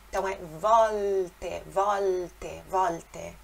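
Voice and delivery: volte slow, L made with tongue behind teeth, not a U; clear Italian L. Shown as a demonstration. Teacher repeating volte to show the L. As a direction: say volte with a real L. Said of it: "a língua vai atrás dos dentes".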